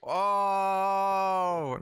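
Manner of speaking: high, drawn-out "ohhh"; long "oh" of realization. Pretend. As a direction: let the penny drop theatrically. Joke finally dawns on them.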